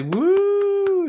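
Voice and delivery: long high celebratory "woo"; high whoop. Real, not acted. Streamer cheering being on live chat, high whoop. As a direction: let out a sustained, very high whoop.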